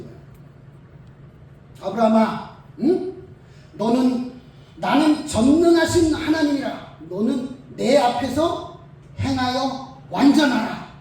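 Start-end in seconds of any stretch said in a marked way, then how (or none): 1.8-10.9 God announcing himself to Abraham, loud and slow at the end; solemn voice of God. Retold or imitated. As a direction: boom it out like God declaring his name.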